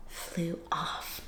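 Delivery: hushed whisper. Acted. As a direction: breathe the words out. Trailing off in story.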